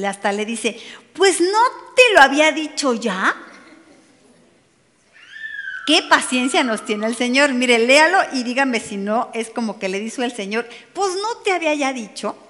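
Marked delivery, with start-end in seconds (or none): 1.1-3.4 raised pitch, loud mock scolding; high, loud exasperated question; exasperated reproach. Acted scolding of Marta. 10.9-12.4 higher-pitched mock scolding; lightly acted, high-pitched reproach; exasperated reproach. Repeats the scolding of Marta.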